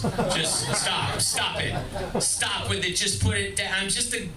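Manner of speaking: ordinary talk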